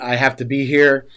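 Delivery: usual speaking voice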